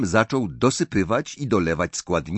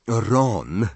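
usual speaking voice

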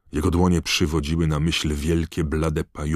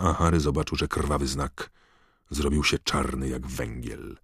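plain reading voice

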